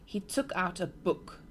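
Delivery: ordinary delivery, nothing marked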